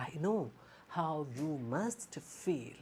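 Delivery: slow and soft, full of sympathy; soft empathetic tone. Shown as a demonstration. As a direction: comfort a hurting listener slowly.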